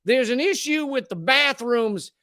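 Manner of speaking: mock Southern bigot complaint; Southern accent. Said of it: "give them a Southern accent".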